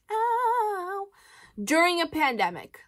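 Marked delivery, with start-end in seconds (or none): 0.1-1.1 squeaky high ow of disgust; a sudden high little ow; high pitched yelp; mock yelp. Reacting to gross lip gloss.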